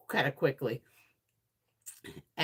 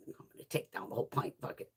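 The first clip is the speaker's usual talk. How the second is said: fast, much softer conspiratorial aside; sneaky hushed decision; 11 semitones lower, 11 dB quieter, faster than usual. Retold or imitated. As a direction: rush it under your breath while he sleeps. Sneaky late-night snack thought.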